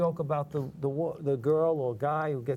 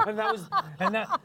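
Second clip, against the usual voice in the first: laughing. Real, not acted. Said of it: "laughing through the line".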